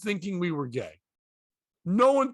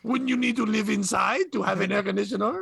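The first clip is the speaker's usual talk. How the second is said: condescending mock-French question; French accent; 3 semitones higher than usual. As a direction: look down on them in a fake French accent. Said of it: "answer in a French accent".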